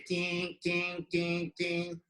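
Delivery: sound-effect tings. Shown as a demonstration. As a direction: voice each pen stroke as a ting. Sounding out jagged pen strokes.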